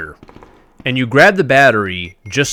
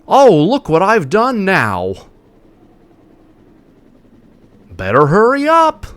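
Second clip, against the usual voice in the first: higher, slow, overly earnest parody; mock wooden line reading; 9 semitones higher, 4 dB louder, slower than usual. Streamer parodies the characters' lines, slow and higher. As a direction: read it like bad nineties voice acting.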